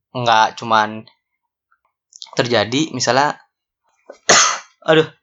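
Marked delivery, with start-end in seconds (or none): 4.1-5.1 coughing then a groaned aduh; coughing fit. Real, not acted. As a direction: cough hard, then sigh. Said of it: "maaf, maaf, batuk".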